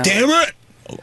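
high-pitched imitation of deaf speech; hammed-up deaf kid's protest; mock deaf speech. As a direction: put on a high, imprecise deaf voice. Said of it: "He didn't talk like that anymore".

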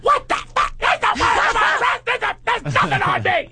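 very high, sputtering shriek; hammed-up hysterical protest; panicked queeny outburst. Imitating friend told of an arrest warrant, mock panic.

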